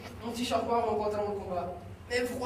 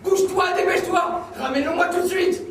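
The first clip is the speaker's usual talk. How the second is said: loud, much higher yelled orders; furious shouting; 9 semitones higher, 7 dB louder than usual. Acted. Son yells orders at his frightened mother. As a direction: scream the commands at her.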